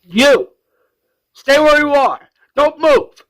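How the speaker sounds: high, loud guard's order; screaming. Retold or imitated. Security guard confronting an intruder, screamed. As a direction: imitate a guard screaming a command. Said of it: "he screams".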